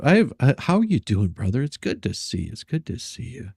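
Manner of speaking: usual speaking voice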